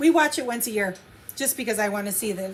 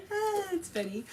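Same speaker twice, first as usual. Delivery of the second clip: laughing; 6 semitones higher than usual. Real, not acted. After long laugh.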